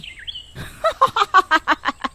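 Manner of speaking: high, long witch cackle, falling away; evil cackle. Acted. Witch as three villagers faint, gloating cackle. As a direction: cackle in triumph over your victims.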